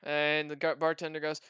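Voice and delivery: usual speaking voice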